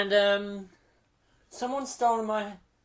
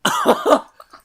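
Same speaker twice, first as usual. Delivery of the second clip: loud high laughing fit; 14 semitones higher, 13 dB louder than usual. Real, not acted. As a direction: cackle helplessly.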